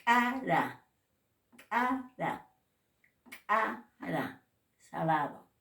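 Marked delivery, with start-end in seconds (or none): 0.0-4.4 CARA opening on a popped ejective k; glottalized K. Shown as a demonstration. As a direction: pop the k of CARA from a closed throat. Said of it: "para la glotalización".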